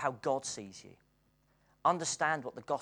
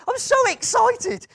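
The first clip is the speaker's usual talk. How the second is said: very high, loud, slow "I'm so excited"; exuberant quote; 15 dB louder, slower than usual. Retold or imitated. Retelling the Kenyan's joy at the service, high loud excitement. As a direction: squeal it out like a joyful friend. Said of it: "he said, he said".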